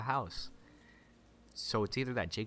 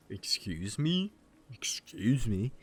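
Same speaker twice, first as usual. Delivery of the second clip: slow, drawn-out disbelief; taken aback; slower than usual. Acted. Retrying the challenge to Sarah, taken aback. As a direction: stretch it out, affronted.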